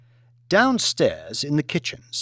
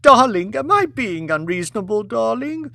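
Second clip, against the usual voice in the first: sobbing plea; 9 semitones higher, 4 dB louder than usual. Acted. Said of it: "She sobbed".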